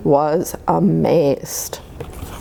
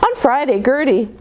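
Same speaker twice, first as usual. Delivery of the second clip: ordinary delivery, nothing marked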